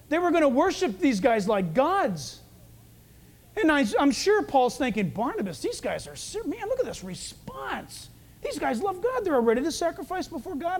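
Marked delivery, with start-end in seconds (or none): 5.1-10.8 soft, high-pitched amazed aside; hushed excitement. Retold or imitated. Paul confiding in Barnabas about the crowd, hushed excitement. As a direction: marvel under your breath, pitch jumping up.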